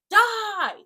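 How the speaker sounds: sudden high furious scream. Retold or imitated. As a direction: scream it as if you want him dead. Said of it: "And Seth is screaming".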